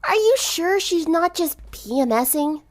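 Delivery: high, slow, wry jab; crunching chips and joking; lightly voiced smirking girl; teasing tomboy. A narrator's reading. Rainbow teasing.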